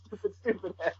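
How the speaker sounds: ordinary talk, nothing marked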